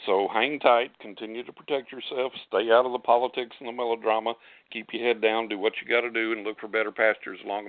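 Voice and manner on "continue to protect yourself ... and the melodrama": ordinary talk, nothing marked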